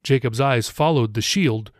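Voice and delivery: plain reading voice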